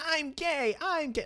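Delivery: high mock announcement; hammed-up high sing-song declaration.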